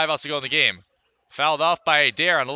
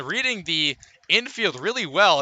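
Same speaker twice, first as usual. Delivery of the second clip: normal talking voice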